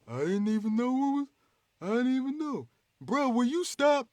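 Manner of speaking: high, whiny, dazed hero voice; flustered Superman. Retold or imitated. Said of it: "and he like".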